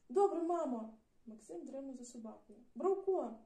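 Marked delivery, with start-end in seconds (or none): 0.1-1.0 loud, higher reply; calling back. Acted. Boy calling back to his mother, loud and eager. 2.7-3.4 loud, high call of the name; calling the dog. Acted. Boy loudly calling after his runaway dog.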